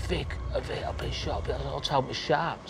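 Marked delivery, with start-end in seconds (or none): 0.0-1.5 weak, breathless whisper; whispered plea. Retold or imitated. Shot passenger whispers to Vic from the seat. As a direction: whisper it like you're wounded. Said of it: "is going".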